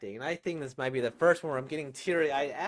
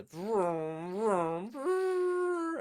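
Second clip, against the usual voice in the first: vroom climbing higher each time into a squeal; car sound effects; 9 semitones higher, much slower than usual. Retold or imitated. Streamer imitating the racing car for viewers, rising playful vroom. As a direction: rev it up like a toy car. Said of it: "making the car sound effects".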